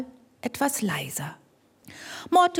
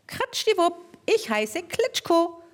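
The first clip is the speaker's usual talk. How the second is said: little mole voice; 8 semitones higher than usual. Acted. Little mole introducing itself to Mortimer in a squeak. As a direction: introduce yourself like a tiny shy animal.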